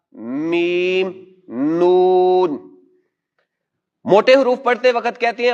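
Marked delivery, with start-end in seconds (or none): 0.1-2.6 میم and نون held very slow and long; drawn-out Arabic letters. Shown as a demonstration. Teacher models the letter names for the class, very slow. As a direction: stretch میم and نون out slowly. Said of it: "جیسے".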